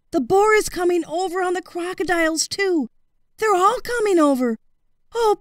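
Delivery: high, urgent pleading cry for help; a trapped dragon begging to be rescued; frantic yelling. A narrator's reading.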